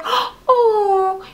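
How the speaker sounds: sharp gasp, then a high drawn-out oh; mock a scandalized onlooker's gasp. The twins hear he loves her, gasped "oh". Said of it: "the twins are like".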